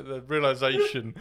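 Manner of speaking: talking through laughter; laughing. Real, not acted. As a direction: laugh while saying it. Laughing at the joke.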